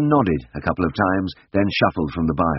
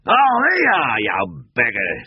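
out of breath, high and loud on the first words; breathless gasp; 9 semitones higher, 3 dB louder than usual. A narrator's reading. Drover catching his breath after chasing the cow, gasping. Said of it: "he gasped".

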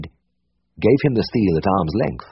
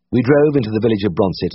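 plain reading voice

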